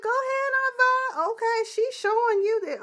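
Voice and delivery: very high, cheering go ahead; high hype cheer. Real, not acted. Cheering Aunt Vi's exit in very high hype voice. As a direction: shout her on like at a runway.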